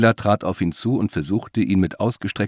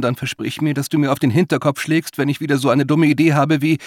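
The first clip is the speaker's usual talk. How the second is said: higher-pitched rant, running on without a break; agitated Mila-like figure; 7 semitones higher, 4 dB louder than usual. Acted. Woman to James about her dumb idea, agitated. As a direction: rattle off the dumb idea in mock enthusiasm.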